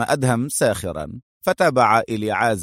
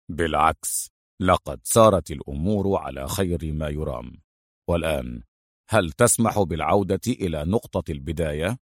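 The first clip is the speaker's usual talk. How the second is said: plain reading voice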